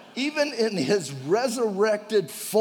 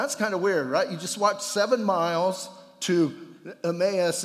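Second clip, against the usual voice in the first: normal talking voice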